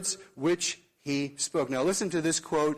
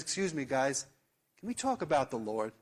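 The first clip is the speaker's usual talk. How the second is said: softer, lower gentle interruption; soft interjection; 3 semitones lower, 5 dB quieter than usual. Retold or imitated. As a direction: cut in quietly to calm a heated group. Brother interrupts a heated argument.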